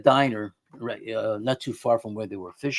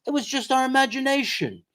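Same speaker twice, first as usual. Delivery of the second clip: high, put-on voice brushing it off; mocking imitation of a dismissive father; 11 semitones higher than usual. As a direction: play down the fright like dad always did. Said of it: "my father would say something like that".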